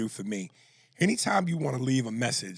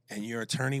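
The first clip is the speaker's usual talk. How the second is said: ordinary talk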